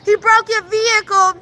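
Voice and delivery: excited tattling. Retold or imitated.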